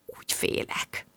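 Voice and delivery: breathless frightened whisper, barely voiced; scared whisper. Alone in the silent flat, whispering her fear. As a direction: hush it out as if someone might hear.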